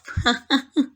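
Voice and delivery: taunting laugh. Acted. Vampire watches hunter freeze up, taunting laugh. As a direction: laugh at their fright.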